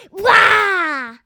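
loud lion roar; big playful lion roar, shouted; playful monster growl. Pretend.

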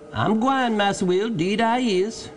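high-pitched dialect servant voice; prompt reply. A narrator's reading. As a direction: answer at once, eager to reassure your master. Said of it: "replied the Negro very promptly".

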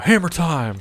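high-pitched mock battle cry; playful imitation of someone yelling; imitated yell. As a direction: call it out like an attacker's catchphrase. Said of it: "Think he was yelling out".

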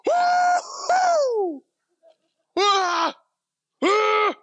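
very high, loud excited squeal; imitating toddler squeal. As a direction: mimic a thrilled toddler shrieking. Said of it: "she was like".

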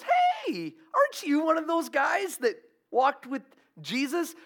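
high-pitched mock challenge; imitated young girl. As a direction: call out high, like an accusing girl. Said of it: "maybe thirteen years old, who goes".

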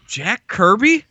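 high, rising unsure "Jack Kirby?"; imitating a clueless friend; puzzled question. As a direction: ask it like the name means nothing to you.

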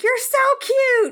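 high, slow, cutesy coo; squeaky Clem voice. Retold or imitated. Friend imitating Clem cooing over Giles, high and slow. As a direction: coo it like a besotted little creature. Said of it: "Clem, who was like".

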